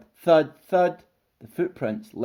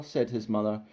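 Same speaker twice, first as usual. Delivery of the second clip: ordinary delivery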